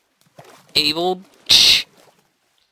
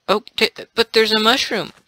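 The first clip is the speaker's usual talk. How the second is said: normal talking voice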